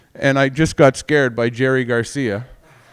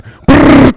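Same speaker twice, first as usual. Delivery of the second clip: loud, high rattling burst; mock gunfire noise; 13 semitones higher, 14 dB louder than usual. Comic recalling a pretend drive-by on his parents, loud mock gunfire. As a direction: imitate a burst of gunfire at full volume.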